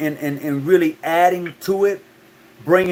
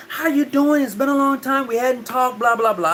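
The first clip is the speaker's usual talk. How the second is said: proper lady voice; 7 semitones higher than usual. Retold or imitated. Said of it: "And she was like".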